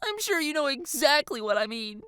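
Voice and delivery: put-on high, whiny girlish voice; exasperated girl. A narrator's reading. Girl to giggling friend, exasperated.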